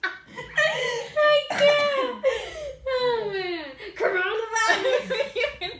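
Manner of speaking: high loud words through laughter; real helpless laughter; laughing hard. Real, not acted. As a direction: squeal it while cracking up.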